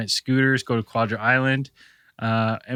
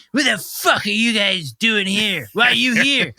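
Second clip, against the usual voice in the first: hostile demand; 8 semitones higher, 4 dB louder than usual. Retold or imitated. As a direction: confront them like an angry local woman. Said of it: "another woman comes up and is like".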